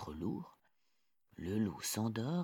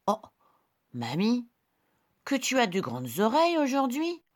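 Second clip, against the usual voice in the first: put-on high, bright little girl; 6 dB louder than usual. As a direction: play the small girl. Girl questions granny.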